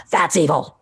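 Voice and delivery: menacing evil voice, hammed up. Shown as a demonstration. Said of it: "That's my evil voice".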